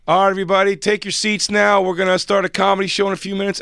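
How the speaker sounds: high, loud DJ announcement; DJ announcing. Retold or imitated. DJ telling the crowd to take their seats. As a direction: call the room to order like a DJ. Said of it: "the D J goes".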